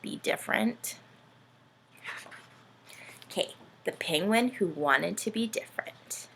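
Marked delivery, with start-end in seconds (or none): none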